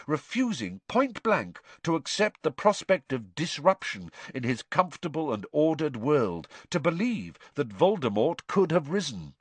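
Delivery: ordinary delivery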